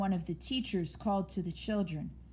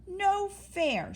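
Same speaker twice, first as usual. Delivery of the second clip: high, loud squealing protest; 12 semitones higher, 3 dB louder than usual. A narrator's reading. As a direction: squeal it out like an indignant little mouse. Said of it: "squealed Mouse".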